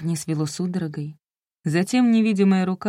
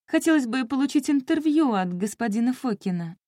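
higher, put-on polite caller voice; posing as journalist; 6 semitones higher than usual. A narrator's reading. Narrator lying to the new wife, put-on reporter voice. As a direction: pitch it up as a pretend reporter.

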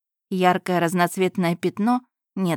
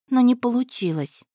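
ordinary delivery

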